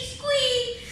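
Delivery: high squeak like a mouse; squeaky squeak. A narrator's reading. Storyteller imitating the mouse's squeak. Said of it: "he heard a squeak".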